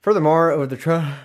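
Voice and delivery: words stretched by a big real yawn. Real, not acted. Bored reader yawning mid-verse. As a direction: read it right through a yawn. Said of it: "jeez, this is an extra yawner".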